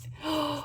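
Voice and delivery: short thrilled intake of breath; soft sharp gasp of joy; delighted gasp. Real, not acted. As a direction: gasp as if seeing treasure.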